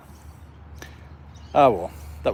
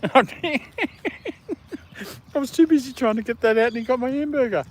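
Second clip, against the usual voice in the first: much higher, talking through laughter; laughing while talking; 14 semitones higher than usual. Real, not acted. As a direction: tell it still laughing. Laughing about the theft.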